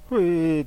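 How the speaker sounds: a high, loud, feeble wail; pathetic whimper. Retold or imitated. Terrified son lets out a pathetic wail, whimpering. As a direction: whimper helplessly in fear. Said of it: "って情けない声出してしまった".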